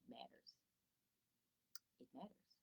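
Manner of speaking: whispered emphasis. Shown as a demonstration. Teacher whispering to parents for emphasis. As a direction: drop to a whisper to drive it home. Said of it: "whispered emphasis".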